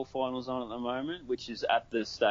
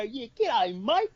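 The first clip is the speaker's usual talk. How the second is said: high, hammed-up Australian "g'day, mate"; mock Australian accent; 12 semitones higher, slower than usual. An Aussie mocking how Americans do his accent, high ocker parody. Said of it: "We don't have to go".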